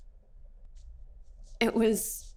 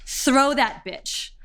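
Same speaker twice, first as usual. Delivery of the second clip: higher, drawn-out mock yell; retold scream; 6 semitones higher, much slower than usual. Guards throwing her into a police van, retold mild yell. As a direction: act out a slow, half-voiced shouted order.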